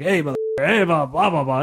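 sing-song bully jabber; needling blah-blah mimicry; mock taunting. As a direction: imitate a pestering kid's empty taunts. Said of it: "he's taunting me. He's just like".

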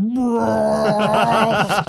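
long drawn-out mock roar; slow-motion roar. Friend retelling his big swing, slow-motion roar. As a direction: bellow like a film in slow motion. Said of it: "it's like the slow motion".

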